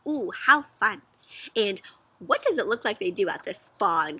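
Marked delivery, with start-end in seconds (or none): none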